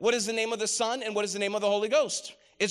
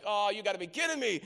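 exasperated aside; 5 dB quieter, faster than usual. Retold or imitated. Exasperated aside about the scene.

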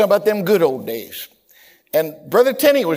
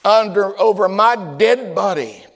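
defiant refusal; 5 semitones higher, 6 dB louder than usual. Retold or imitated. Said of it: "And he said this".

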